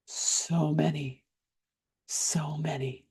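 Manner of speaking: slow, hushed near-whisper; the overburdened body answering in a slow whisper. Retold or imitated. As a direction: draw it out softly, fading into a whisper. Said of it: "I heard the body go".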